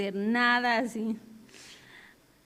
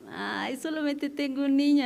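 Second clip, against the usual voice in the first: a light imitation of her own disappointed whine, pitched higher; mock sad lament; 4 semitones higher than usual. Woman mimicking her sad self over one student, lightly whiny. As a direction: play up a small, self-pitying complaint about having one pupil. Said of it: "me ponía así como triste".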